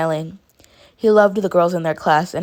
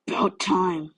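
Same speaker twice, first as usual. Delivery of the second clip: grumbled; slower than usual. A narrator's reading. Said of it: "Katsuki grumbled".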